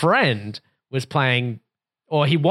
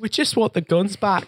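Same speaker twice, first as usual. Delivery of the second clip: higher-pitched British parody; mock British accent; 7 semitones higher than usual. Host mocks cousin's video appeal, higher British accent. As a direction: play a pleading Brit, pitched up. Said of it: "British accent".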